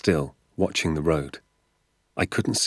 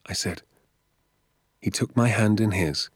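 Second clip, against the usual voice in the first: usual speaking voice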